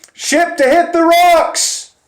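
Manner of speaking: high, loud shout down the hatch; yelled warning. Retold or imitated. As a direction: call it down to the captain with alarm. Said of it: "I yelled down the trap".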